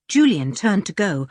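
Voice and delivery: ordinary delivery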